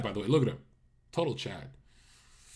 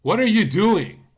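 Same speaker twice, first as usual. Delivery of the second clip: loud, higher yell on what are you doing; exasperated yell; 7 semitones higher, 9 dB louder than usual. Real, not acted. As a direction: yell it at him in disbelief. Exasperated at Sonny.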